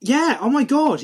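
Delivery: usual speaking voice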